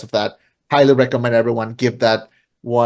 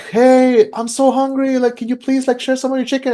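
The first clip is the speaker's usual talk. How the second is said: put-on much higher, pleading old man; 11 semitones higher than usual. Old man begging the hungry man for chicken, in a much higher pleading old voice. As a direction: beg for food like a frail old man. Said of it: "an old man shows up".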